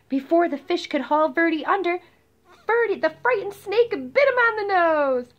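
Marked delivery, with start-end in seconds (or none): none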